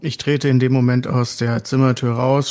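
ordinary delivery

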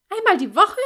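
high, loud shocked squeak. A narrator's reading. Horrified flatmate hearing once a week, squeaking. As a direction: squeal it in horror. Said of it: "quiekte ich".

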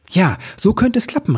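high, eager little elf; excited elf voice. A narrator's reading. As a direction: call it out like a hopeful elf. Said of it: "rief Ribbelwitsch".

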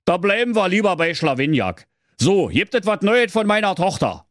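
an impatient king pressing for news; excited old king. Acted. King urging his breathless servant, high and eager.

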